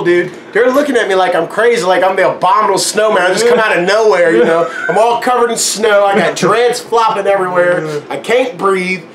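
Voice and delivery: normal talking voice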